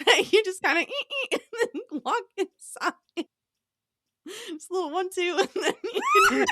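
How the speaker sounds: giggling through the words; can barely hold back laughter; laughing while talking. Real, not acted. Giggling through absurd church image.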